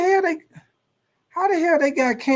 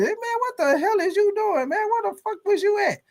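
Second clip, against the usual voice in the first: high, strained teammate voice; a teammate demanding where he went; outraged teammate; 14 semitones higher than usual. Acted. Teammate confronts quarterback.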